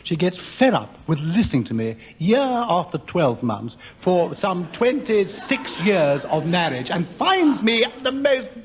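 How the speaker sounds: ordinary delivery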